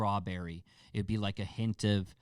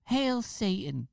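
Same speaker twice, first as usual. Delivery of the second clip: high, drawn-out squeaky mimicry; imitated killer's taunt; 10 semitones higher, slower than usual. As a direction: stretch it out high, mocking his voice. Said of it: "what he said was".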